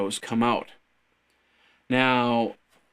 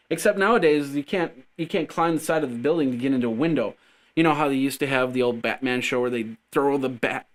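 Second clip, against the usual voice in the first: usual speaking voice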